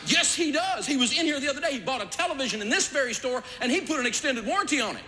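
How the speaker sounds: hammed-up indignant clerk; mock indignant salesman. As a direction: play a salesman puffed up and sure. Puffed-up salesman insists.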